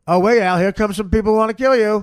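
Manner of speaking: usual speaking voice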